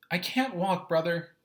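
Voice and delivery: put-on high, plaintive little boy; Doodle's voice. Doodle telling brother he can't walk, high and plaintive. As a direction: protest helplessly like a small child.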